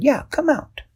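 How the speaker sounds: high, coaxing call; high-voiced friend coaxing a shy boy out. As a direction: lure him out gently.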